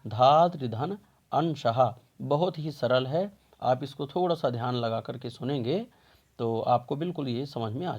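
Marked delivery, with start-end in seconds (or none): 0.1-1.9 धात्रिधन अंशः drawn out, parts separated; slow teacher dictation; slow careful split. Shown as a demonstration. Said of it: "धात्रिंश की हम संधि विच्छेद देखेंगे".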